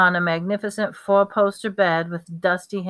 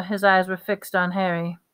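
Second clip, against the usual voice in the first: usual speaking voice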